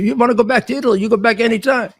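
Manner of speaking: put-on high-pitched Italian-accented taunt. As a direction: imitate an Italian immigrant grandfather's challenge. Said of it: "he would say in his Italian accent".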